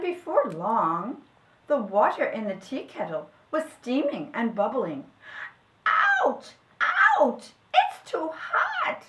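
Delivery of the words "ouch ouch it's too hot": very high, loud squeal of pain; tiny creature yelping from the heat; squeaky little voice, crying out. A narrator's reading. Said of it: "cried a little voice".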